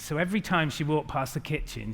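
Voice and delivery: ordinary delivery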